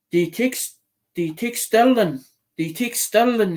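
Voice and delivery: lightly put-on Irish accent asking a question. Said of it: "in his Irish accent".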